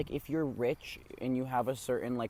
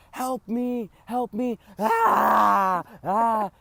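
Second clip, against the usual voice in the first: high, loud screaming for help; mock blood-curdling scream; 8 semitones higher, 9 dB louder, much slower than usual. Kids prank-calling a stranger, hammed-up screams for help. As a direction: shriek like someone in danger on a prank voicemail. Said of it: "give a blood-curdling scream".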